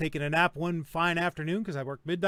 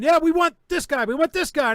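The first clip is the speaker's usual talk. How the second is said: higher and louder mock officer shout; retold screaming; 8 semitones higher, 7 dB louder than usual. Marshals demanding their suspect from the homeowner, mock yell. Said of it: "then they start screaming".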